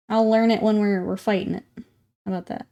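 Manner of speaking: ordinary talk, nothing marked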